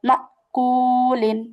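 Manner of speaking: মা কুলিন drawn out very slowly, each sound held; slow recitation demo. Shown as a demonstration. Teacher showing students the throat sound, slow drawn-out recitation. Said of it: "হলকে শুরু থেকে নিয়ে আসছি কিন্তু".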